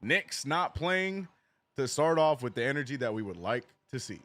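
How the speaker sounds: ordinary talk, nothing marked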